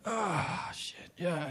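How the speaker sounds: very soft, sinking stretch groan; morning stretch groan. Acted. Acting out the morning stretch on waking, soft relieved groan. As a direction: let it out softly as if stretching awake. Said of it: "you do that morning stretch thing".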